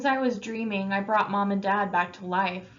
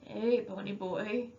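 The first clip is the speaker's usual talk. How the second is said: soft, slow sleepy mumble; just woken, drowsy greeting; half-asleep brother on the sofa; 5 dB quieter than usual. Acted. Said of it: "he said sleepy".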